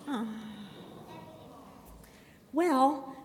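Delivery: ordinary delivery, nothing marked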